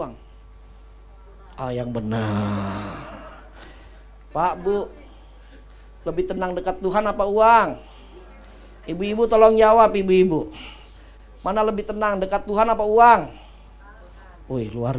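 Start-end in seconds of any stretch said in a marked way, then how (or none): 1.5-2.9 teasing disbelief. Shown as a demonstration. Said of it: "teasing doubt to congregation". 8.8-13.3 loud call to the crowd. Real, not acted. Preacher calling the mothers to answer, loud and insistent. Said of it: "calling on congregation to answer".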